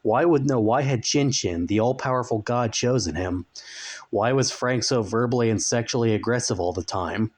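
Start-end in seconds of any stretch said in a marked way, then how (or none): none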